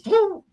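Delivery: high loud whoop; mock battle cry whoop; war whoop. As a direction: whoop as you charge in. Charging in like a missile.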